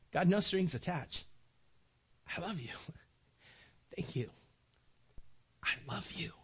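soft, hushed heartfelt prayer; tender prayer. Pretend. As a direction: pray quietly as to a father. Voiced prayer to God.

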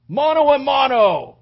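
booming drawn-out battle challenge, pitched high; Goliath's screamed challenge. Acted. Giant warrior challenges the enemy army to single combat, screamed. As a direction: bellow it like a giant daring an army. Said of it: "Goliath screams out".

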